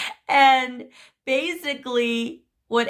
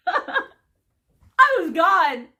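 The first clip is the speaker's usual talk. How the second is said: laughing through the words, slowly; 8 semitones higher, much slower than usual. Real, not acted. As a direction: laugh while you say it, drawn out. Laughing about losing it.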